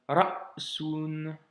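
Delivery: "ra'sun" slowly, a stop after "ra" before the s; "ra'sun": "ra", catch, "sun", no vowel between; sukun stop. Shown as a demonstration. Teacher models "ra'sun" slowly, stop after ra. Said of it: "avec le soukoun".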